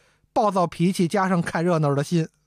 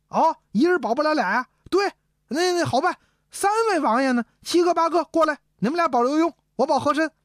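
higher, eager, meddling prince keen on a fight; a prince delighted to stir up trouble, pitched up; 9 semitones higher than usual. Acted. Eager prince proposing a bet. (爱看热闹的王爷，兴冲冲地高声出主意)

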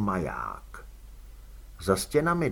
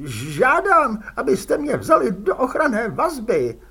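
high, loud nervous complaining voice; a cornered little crook whining his grievance; whiny prisoner; 11 semitones higher, 7 dB louder than usual. A narrator's reading. Fredka begs protection.

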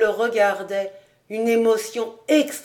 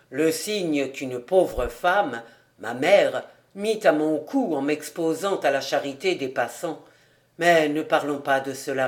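voix de père plus basse, posée; père charbonnier, grave; 6 semitones lower than usual. A narrator's reading. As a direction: confier un souvenir à sa fille d'un ton grave. Narrator voices the father. (voix grave d'un père qui se souvient)

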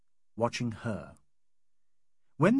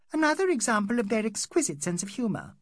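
high, lilting mock appreciation; high-pitched singsong jibe; sing-song sarcasm; 10 semitones higher, 3 dB louder than usual. A narrator's reading. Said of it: "sang Miss Derrick".

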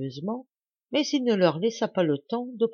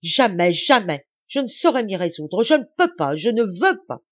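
loud, insistent, climbing higher on je ne peux pas; raging refusal; 3 semitones higher, 8 dB louder than usual. A narrator's reading. Lacheneur rages that he cannot give up the château, loud and frenzied. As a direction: hammer every refusal, beside himself. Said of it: "avec un emportement effrayant".

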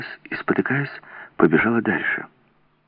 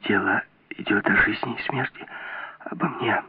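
whispered urgent plea; hushed and agitated; breathless whisper; 6 dB quieter than usual. A narrator's reading. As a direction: whisper it softly, caught up in agitation. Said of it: "прошептала она, задыхаясь от волнения".

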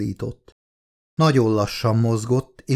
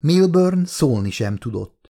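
ordinary delivery, nothing marked

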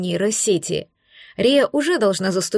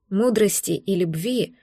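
ordinary delivery

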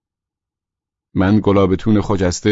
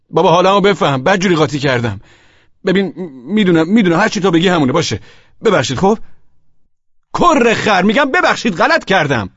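raised, loud, pleading insistence; exasperated apology; 6 semitones higher, 4 dB louder than usual. Acted. Husband apologises to offended friend, exasperated apology. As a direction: plead until it bursts out in annoyance.

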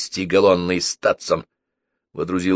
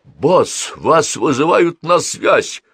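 excited shout, higher and louder; excited yell; 9 semitones higher, 5 dB louder, much slower than usual. A narrator's reading. Henchman calling the boss to the radio, excited yell. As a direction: yell it out, stretched and urgent. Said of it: "возбуждённо заорал он".

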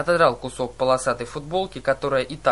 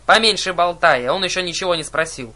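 loud, higher-pitched scolding; exclaimed scolding; 4 semitones higher, 7 dB louder than usual. A narrator's reading. Sisters telling off the chatty one, exclaiming crossly. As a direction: shut her up with a loud rebuke. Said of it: "восклицая".

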